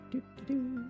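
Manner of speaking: ordinary delivery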